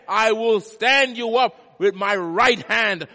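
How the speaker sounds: loud, high scripture proclamation, drawn-out key words; full-force preaching. Real, not acted. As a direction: thunder God's promise out to the congregation.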